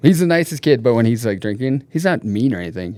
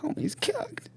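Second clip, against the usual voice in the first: breathy whispered aside; hushed mock verdict; whispered joke; 11 dB quieter, slower than usual.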